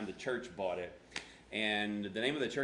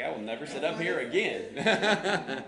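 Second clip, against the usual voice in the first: loud, slow, higher vow with laughter; mock indignation; 5 semitones higher, 7 dB louder, slower than usual. Pastor imitating a put-upon congregant, mock indignant. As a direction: drag out the offended vow, half laughing.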